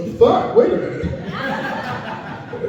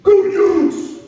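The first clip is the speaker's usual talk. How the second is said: high, loud mock cheer from the deli guy; 11 semitones higher, 4 dB louder than usual. Grocery counter guy cheering the comic's team, high and loud. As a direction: belt it like a chipper sports fan. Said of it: "guy behind the counter goes".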